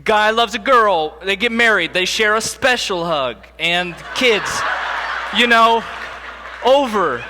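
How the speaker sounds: dad imagining his awkward birds and bees speech; mock rehearsed talk. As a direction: rush through the birds and bees in mock earnest.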